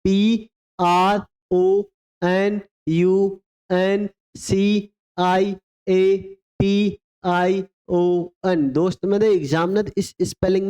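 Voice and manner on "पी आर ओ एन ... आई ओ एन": slow letters, each one drawn out; teacher repeating the spelling slowly; slow spelling dictation. Shown as a demonstration. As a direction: spell it slowly for them to follow. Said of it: "एक बार फिर से repeat करो".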